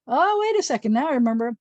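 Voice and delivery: high, bright oh of sudden recall; mock realization. Teacher playing a student who suddenly remembers. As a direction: act out remembering all at once. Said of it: "teacher acts remembering".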